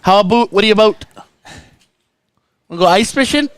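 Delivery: put-on light teasing Minnesota accent, pitched a bit up; mock northern accent. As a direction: poke fun with a playful northern US accent. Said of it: "it's the northern accent for me".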